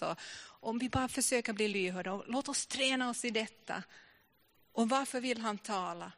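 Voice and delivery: ordinary talk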